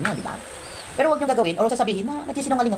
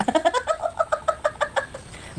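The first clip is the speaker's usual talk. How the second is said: long high-pitched titter; high giggle. Real, not acted. Vlogger gloating to viewers, long high titter. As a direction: titter away in delight. Laughing at her own cleverness.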